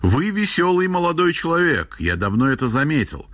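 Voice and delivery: a hint of a formidable elder; stern old man. A narrator's reading. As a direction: pronounce it with mock severity, as an old man. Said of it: "слишком грозно для своих лет произнёс".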